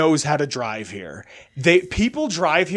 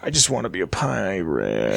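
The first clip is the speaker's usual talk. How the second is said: low, softened voice, pirate stretched out; mock-solemn longing; 9 semitones lower, 4 dB quieter than usual. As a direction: draw it out low, like a deep wish. Playfully declares a pirate wish.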